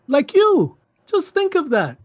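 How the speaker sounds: high-pitched, excited urging; eager persuasion. Acted. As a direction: win them over with rising enthusiasm. Uncle eagerly sells the visitor.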